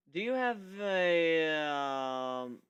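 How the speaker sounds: slow, fumbling hesitation; stumbling. Acted. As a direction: fake searching for the word. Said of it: "I stumbled".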